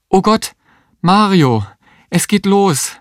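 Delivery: high, alarmed calling out; acted high-pitched panicky call; urgent cry. A narrator's reading. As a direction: cry out that labour is starting. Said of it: "rief die Existenzialistin".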